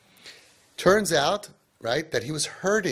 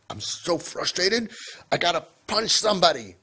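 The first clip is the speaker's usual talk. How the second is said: frustrated big guy; 5 semitones higher than usual. Retold or imitated. Big guy in the car, venting that he must punch someone, higher and worked up. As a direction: voice a muscular guy ready to blow. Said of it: "and he's like".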